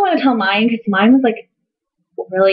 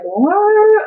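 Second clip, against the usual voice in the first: high, drawn-out astonished question; mock shock; 12 semitones higher than usual. Diners staring after dad's announcement, mock shock. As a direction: imitate stunned onlookers, pitched way up.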